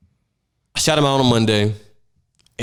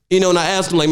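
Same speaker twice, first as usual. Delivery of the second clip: usual speaking voice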